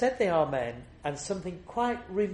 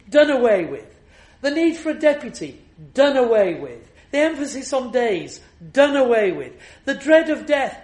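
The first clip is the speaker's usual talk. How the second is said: preaching at full force; 8 semitones higher, 9 dB louder than usual. Acted. Preacher to his congregation on the cross, thundering sermon. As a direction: proclaim it to the back of the church, hammering every refrain.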